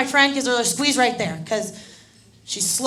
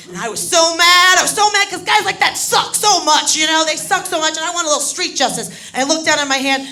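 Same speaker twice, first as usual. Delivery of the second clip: loud, high comic outrage; worked-up rant; 6 semitones higher, 7 dB louder than usual. Retold or imitated. Comic telling the crowd about guys like that, outraged. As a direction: rant as if still furious.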